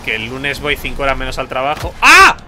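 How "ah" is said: loud, high-pitched cry of disbelief; yelp, then question it loudly and high; startled yell. Real, not acted. Player caught out mid-chat by the game, startled.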